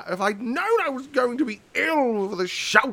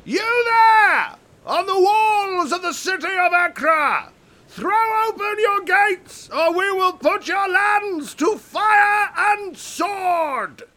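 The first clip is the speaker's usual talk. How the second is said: much higher, loud challenge; shouting; 9 semitones higher, 4 dB louder than usual. Acted. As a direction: bellow it up at the walls. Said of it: "I shall shout from my stretcher".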